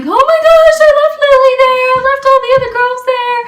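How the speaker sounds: high, loud, fast tearful wail; tearful panic. Retold or imitated. Girl sobbing about leaving her friends, high tearful wail. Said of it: "I was, like, sobbing".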